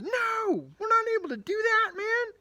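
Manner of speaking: very high, loud, fast panicky refusal; panicked crowd. Retold or imitated. The crowd refuses Caleb, in a shrieking panic. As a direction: squeal in alarm. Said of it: "and then everyone says".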